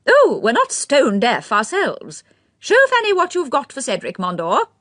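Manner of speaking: put-on high, fluttery elderly aristocrat; old lady's voice. A narrator's reading. Lady Mondor speaks.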